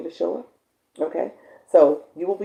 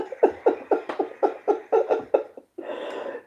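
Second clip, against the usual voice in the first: squealing giggle of joy; excited laughing squeal; high delighted laugh. Real, not acted. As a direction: giggle high and breathless with glee. Delighted laugh after news.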